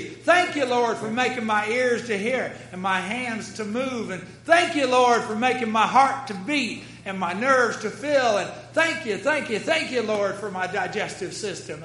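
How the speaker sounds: loud, emphatic prayer of gratitude; exuberant thanks. Acted. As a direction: pile up the thank-yous with full force.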